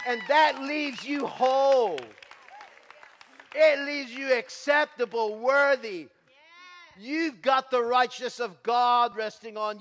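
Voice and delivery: loud, high, rising preacher's cry; full-force preaching. Acted.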